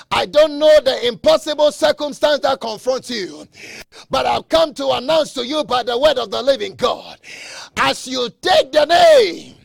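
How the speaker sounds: loud, ringing pulpit proclamation; preaching at full force. Acted. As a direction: preach it out to the whole church.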